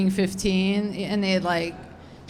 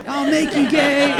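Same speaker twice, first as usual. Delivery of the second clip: laughing; 7 semitones higher, 4 dB louder than usual. Retold or imitated. Teen self, laughing threat.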